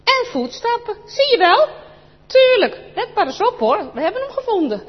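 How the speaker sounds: put-on high, loud triumphant boy; a kid sure he has found the treasure; excited boy. Chris to Anneke, high loud triumphant excitement.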